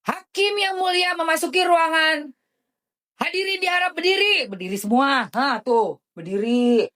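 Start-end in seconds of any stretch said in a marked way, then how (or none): 0.0-4.5 high formal announcer call; court announcer. Retold or imitated. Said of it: "begitu beliau masuk". (panitera mengumumkan hakim masuk, suara tinggi dan resmi)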